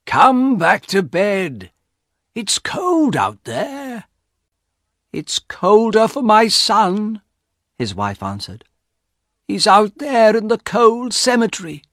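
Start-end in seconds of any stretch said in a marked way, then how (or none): 0.1-4.1 put-on high, drawn-out call from the old husband. A narrator's reading. Husband calls wife back to bed from the cold, calling out. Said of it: "and he called". 5.1-7.2 high, slow, aching reply; grieving wife. A narrator's reading. Wife answers husband about her dead son, grieving. 9.5-11.8 high-pitched, anguished lament; grieving wife. A narrator's reading. Wife mourns son in the cold cemetery, anguished.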